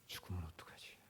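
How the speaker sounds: breathy, hushed worry; low, whispered self-doubt; fearful whisper to himself. Retold or imitated. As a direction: whisper it like a secret fear. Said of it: "나는 있더라고요".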